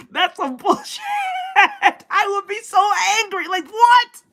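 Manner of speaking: half-laughing, half-shouting disbelief; laughing outrage. Real, not acted. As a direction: cackle through the indignation. Outraged laughing at friend's firing.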